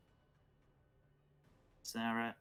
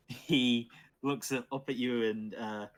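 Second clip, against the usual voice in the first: normal talking voice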